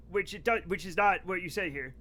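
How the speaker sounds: usual speaking voice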